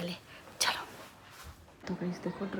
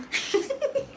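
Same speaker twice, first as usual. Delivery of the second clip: laugh. Real, not acted. Giggling at the prank setup.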